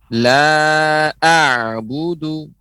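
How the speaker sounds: slow chanted "La a'budu" with the ain flowing softly; long "La", then a smooth throaty ain in "a'budu". Shown as a demonstration. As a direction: let the ain in "a'budu" sound on gently. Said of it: "yaitu lembut memantulnya".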